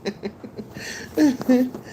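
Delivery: high little bursts of giggles; laughing. Real, not acted. Woman thanking a viewer, high giggling.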